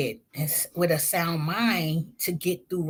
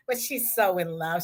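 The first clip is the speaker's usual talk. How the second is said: usual speaking voice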